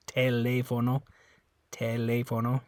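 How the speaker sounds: "Teléfono" with a clear L and pure O's; Spanish teléfono. Shown as a demonstration. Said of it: "sorry, I said that wrong".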